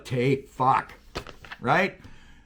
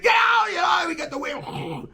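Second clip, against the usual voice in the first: high urgent yell, fading; shouting over wind and rain; retold shout; 11 semitones higher than usual. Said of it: "the voice, like".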